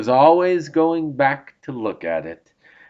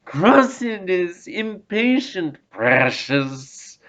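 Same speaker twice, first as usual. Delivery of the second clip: higher, slow hissing creature voice; hissed Gollum; 6 semitones higher, slower than usual. A narrator's reading. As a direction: hiss it out like a peevish creature. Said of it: "hissed Gollum".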